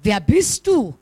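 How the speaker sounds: calling out across a valley; curious call. A narrator's reading. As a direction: call out wondering who's there. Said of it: "Neugierig ruft er".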